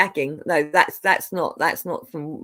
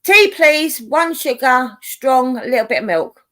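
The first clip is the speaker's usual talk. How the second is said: raised, higher-pitched tea order; calling out; 5 semitones higher, 4 dB louder than usual. Real, not acted. Ordering a cuppa from the one making it, calling out. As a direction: call the order across to someone.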